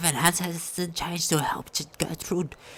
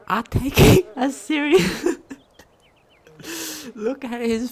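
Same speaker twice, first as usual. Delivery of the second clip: laughing; 6 semitones higher, 7 dB louder than usual. Real, not acted. Laughs mid-sentence while reading.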